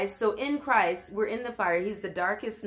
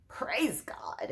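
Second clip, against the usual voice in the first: soft whisper of praise; 3 semitones higher, 6 dB quieter than usual. Real, not acted. Preacher trusting God even in death, whispering. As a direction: breathe it out reverently.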